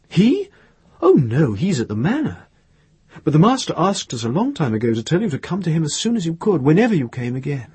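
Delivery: high surprised start, then a quick rush; animated Palazot. A narrator's reading.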